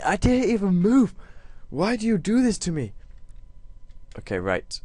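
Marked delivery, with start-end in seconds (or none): 0.0-2.9 high, whining protest; whiny complaint. Real, not acted. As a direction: plead with the game. Whining at game.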